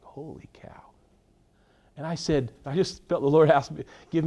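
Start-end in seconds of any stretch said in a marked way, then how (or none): 0.0-0.8 breathless whisper to herself; whispered holy cow. Retold or imitated. Speaker inwardly reacting to the lady's anger, whispered. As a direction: mouth it in a shocked whisper. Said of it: "And I was like".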